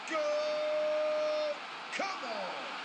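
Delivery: high, ringing cry of Goal!; goal shout. Real, not acted. Player scoring in the game, shouting goal.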